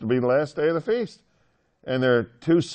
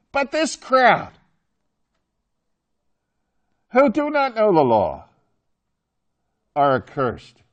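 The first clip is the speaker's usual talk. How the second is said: high, loud, slow scorn; scornful Sanhedrin; 9 semitones higher, 7 dB louder than usual. Acted. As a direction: look down on the crowd from above, much higher and louder. Sanhedrin scorn voiced, later snarling.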